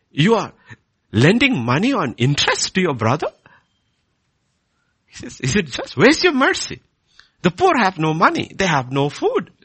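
loud, high indignant rebuke; fiery preaching. Acted. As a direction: thunder the accusation at the congregation. Preaches Nehemiah's rebuke at full force.

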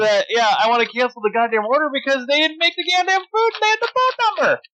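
high, furious retort; retold angry outburst. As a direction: spit it out at the manager, pitch way up. Retold angry reply to son.

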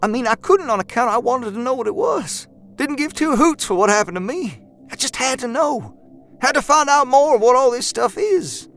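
put-on a wide-eyed kid who must know; fervent young man voice. A narrator's reading.

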